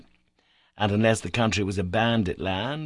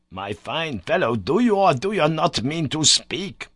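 exasperated cry; 7 semitones higher, 5 dB louder than usual. A narrator's reading. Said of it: "cried my uncle".

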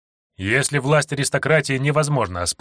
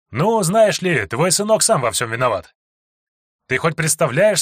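raised, mocking challenge; defiant taunt; 5 semitones higher, 3 dB louder than usual. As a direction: throw the blame back at him, loudly. Hero taunts the snake lord. (дерзкий, насмешливый вызов)

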